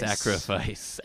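slow, stretched-out mock villain delivery; drawn-out villain line. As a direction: draw the word out, quoting the villain. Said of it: "he whispers into his girlfriend's ear".

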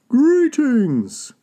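high, loud, long-carrying hail; calling out. A narrator's reading. Wolf hails Tortoise from the shore, calling out. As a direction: call across from the shore as Wolf. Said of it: "called out Wolf from the shore".

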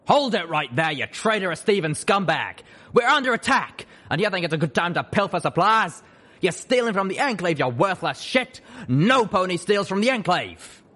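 loud, high, shouting sergeant; furious drill sergeant. Acted. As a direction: bellow at the thief.

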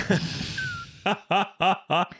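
high burst of laughter; giggling fit. Real, not acted. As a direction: break into a giggle. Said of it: "I'm sorry".

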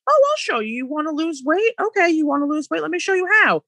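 high, playful voice of the universe; universe talking back. Retold or imitated. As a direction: sing it out high like the universe teasing. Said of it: "and the universe goes".

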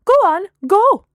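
loud, high shooing shout. A narrator's reading. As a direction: shout at them to clear off. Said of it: "Gemma shouted at them".

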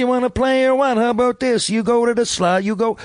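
high, drawling Newfoundland character voice; Ted Hitchcock. Acted. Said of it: "it's more of a drawl".

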